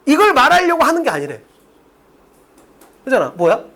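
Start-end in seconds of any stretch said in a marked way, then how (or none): none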